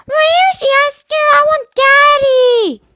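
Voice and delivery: little sister whining in fear; whining little girl. Acted. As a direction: whine for Daddy like a frightened kid. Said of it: "starting to whine".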